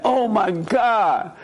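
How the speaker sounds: high, drawn-out mock panic; overreacting. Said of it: "the moment you overreact to that".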